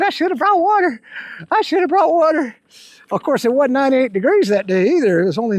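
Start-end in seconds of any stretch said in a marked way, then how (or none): 0.0-2.5 high whiny mock sob, imitating himself; mock crying. Hiker retelling the day without water, mock crying. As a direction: whimper it like a self-pitying kid, half-joking. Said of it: "I was crying, going".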